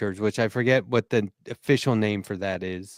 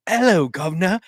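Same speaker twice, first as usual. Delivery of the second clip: hammed-up Cockney British accent, higher and slow; over-the-top British accent; 5 semitones higher than usual. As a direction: ham up a cartoon English accent, pitched up. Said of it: "like, way over the top".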